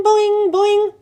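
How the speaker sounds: loud, high twanging boings; a boy mimicking the frog's boing; mock boing. A narrator's reading. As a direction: copy the frog's boing loudly. Kirk mocks frog call.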